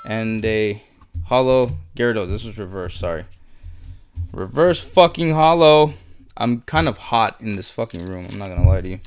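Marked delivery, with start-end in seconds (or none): 4.3-5.9 louder, slow, drawn-out thrill over the card; excited. Real, not acted. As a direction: savor each word of the pull. Pulls reverse holo card.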